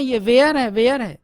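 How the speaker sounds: वेयर said loud with a clear ए, not वियर; teacher correcting वेयर firmly; correct pronunciation demo. Shown as a demonstration. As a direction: stress वेयर, open ए sound. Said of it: "ये वियर नहीं है".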